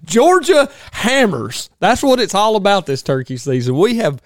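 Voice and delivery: loud, high-pitched hype; hyped host bragging. Acted. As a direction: crow about the team to listeners. Host hypes the turkey season.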